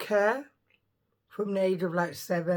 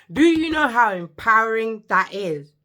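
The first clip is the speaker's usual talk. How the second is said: emphatic; 4 semitones higher, 10 dB louder than usual. Real, not acted. Louder emphatic question.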